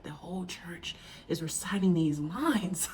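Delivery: put-on whispered in awe as a child; awed whisper. Recalling her child self watching the church recite, awed whisper. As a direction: hush it like a wide-eyed kid in church. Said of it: "I was just like".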